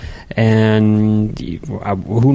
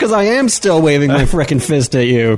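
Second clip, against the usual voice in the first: higher-pitched, exasperated comeback; still angry; 5 semitones higher than usual. Real, not acted. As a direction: fire back as if shaking a fist. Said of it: "you're still angry".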